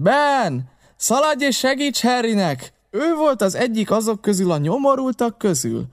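high, indignant calling voice; Silver's outcry. A narrator's reading. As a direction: shout orders across the tavern. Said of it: "kiáltott föl Silver".

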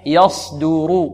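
very slow "يصدر", each syllable drawn out; repeat-after-me model. Shown as a demonstration. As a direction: model "يصدر" for students with thick ص and light د. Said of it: "انطقوا معي". ("يصدر" بتمهل للتلقين)

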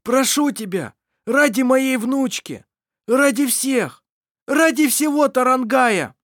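high, loud imploring; begging. Acted. Irovar imploring Mar for his granddaughter, loud and high. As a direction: plead with rising urgency.

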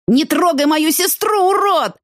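slow, high, raging bellow; furious roar. A narrator's reading. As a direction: roar it to protect your sister. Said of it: "взревел Лёшка".

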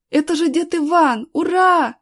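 high, loud joyful exclamation; excited cheer. A narrator's reading. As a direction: burst out cheering at the rescuer's arrival. Boy recognises rescuer, cheers. (радостный громкий возглас)

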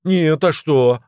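high, loud, assertive comeback; pushy. A narrator's reading. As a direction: fire the question back forcefully. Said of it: "ответил напористо Грыжа".

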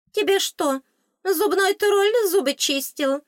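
high, loud, slow squeaky jab; a tiny fairy mocking her teeth; high fairy sarcasm; sarcastic fairy. A narrator's reading.

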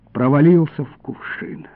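ordinary delivery, nothing marked

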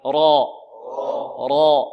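loud heavy را. Shown as a demonstration. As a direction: drill را loud. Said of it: "انطقوا معي".

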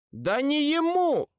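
high, drawn-out angry cry; ringing with anger. A narrator's reading. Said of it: "Мой голос тоже звенел от злости". (звенящим от злости голосом)

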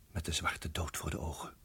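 whispered, holding breath; fearful whisper. Acted. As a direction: barely breathe the words. Spanning, dood voor de ogen. (gefluisterd van angst)

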